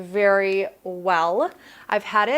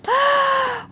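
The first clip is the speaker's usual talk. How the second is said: sharp high gasp of delight; excited gasp; 6 dB louder than usual. Real, not acted. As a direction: gasp as if seeing a treasure. Unboxing new Bible.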